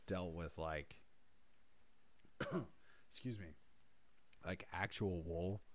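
hoarse, fading losing voice. Real, not acted. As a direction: voice giving out, rough and quiet. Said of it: "I'm losing my voice".

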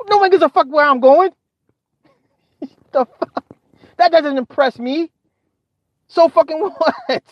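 high-pitched, amused scoffing; scornful dismissal. Acted. Viewer mocking streamers' travel plans, scornful and amused. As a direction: scoff up high with laughs breaking in.

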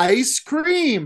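mock loud tourist. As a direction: yell "Ice cream" slowly at a foreigner. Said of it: "loudly and slowly".

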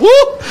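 a high squeaky whoop like a fart; squeaky fart noise. Retold or imitated. Mocking the Pope in his Pope mobile, a high squeaky whoop. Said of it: "just sit there and went".

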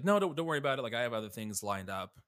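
high, loud, hurried reassurance; pushing him off. Retold or imitated. Zoe to worried dad about lost job, brushing him off. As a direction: wave the worry away, voice jumping up. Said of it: "Just trying to push him off".

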